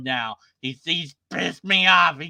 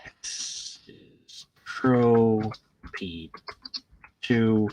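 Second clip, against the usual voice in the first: drawn out; 6 semitones lower, much slower than usual. Real, not acted. Slow despairing read.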